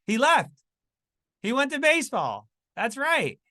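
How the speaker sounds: high, loud and punchy; excited. Real, not acted. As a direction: announce it with excitement. Excited answer to own quiz.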